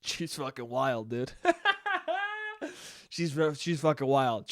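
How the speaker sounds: ordinary delivery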